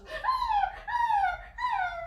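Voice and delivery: high dog wails; shrieking dog howl. Acted. Dogs stuck in the lake, high shrieking howls. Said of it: "Shrieked the dogs".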